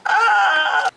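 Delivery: sudden high, loud yelp at the mess; startled yelp. Real, not acted. Cooking fails and she yelps in alarm. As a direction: let out a sharp shocked cry. Dessert goes wrong.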